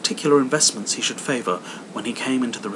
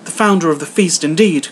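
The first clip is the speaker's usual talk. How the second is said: higher, loud scornful retort; wife scoffing at the toast, higher and loud; indignant Mrs Cratchit; 7 semitones higher, 10 dB louder than usual. Acted. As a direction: throw his words back with scorn. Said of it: "cried Missus Cratchit".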